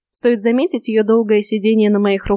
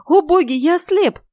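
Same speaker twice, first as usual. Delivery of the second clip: high-pitched panicked wail; acted panic at sudden blindness; horrified cry; 9 semitones higher than usual. A narrator's reading. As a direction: call on the gods in terror. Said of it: "с неподдельным ужасом закричал он".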